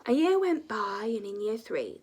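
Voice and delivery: plain reading voice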